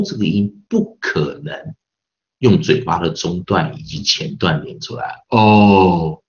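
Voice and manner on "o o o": O formed far back near the throat, loud and held; long, loud demo O, placed at the back; back-cavity O. Shown as a demonstration. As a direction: sustain each O from the back of the mouth. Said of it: "你一定是后腔音了".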